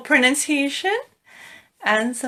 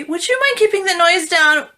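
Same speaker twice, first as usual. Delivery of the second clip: polite complaint; 7 semitones higher, 4 dB louder than usual. Shown as a demonstration. Acted complaint to noisy neighbours at night.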